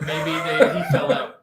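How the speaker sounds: breaking up into laughter mid-sentence; loud, laugh through the words; real helpless laughing delivery. Real, not acted. Joking, cracks up at own suggestion.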